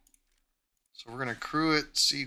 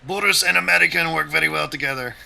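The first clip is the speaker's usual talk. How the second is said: higher, louder, fast hammed-up Russian accent; terrible Russian accent; 5 semitones higher, 11 dB louder than usual. Cheering on teammate Boris, in a terrible Russian accent. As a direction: ham up a bad Russian accent, praising the teamwork. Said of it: "That's my terrible Russian accent".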